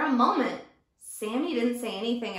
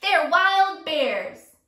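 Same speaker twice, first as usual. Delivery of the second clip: slow, louder threat; bully yell; 3 semitones higher, 7 dB louder, much slower than usual. Acted. Teacher as Sammy, slowly yelling a threat. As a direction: lean on each word to scare him. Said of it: "he yelled".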